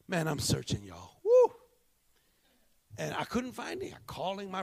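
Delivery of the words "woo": sudden high loud woo; high whoop. Real, not acted. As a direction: whoop with excitement. Preacher's exclamation.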